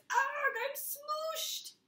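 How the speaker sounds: very high, very slow cartoon wail; squashed fruit wail. Apricot crying out as Rock smooshes it, slow squeaky wail.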